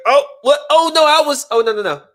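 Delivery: high, loud flustered backpedal; mock flustered woman. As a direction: squeal it like a woman caught out. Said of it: "she'll be like".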